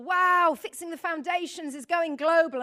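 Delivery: loud, high retold enthusiasm; excited. Friend messages news of global growth, excited. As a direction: burst out with an impressed wow.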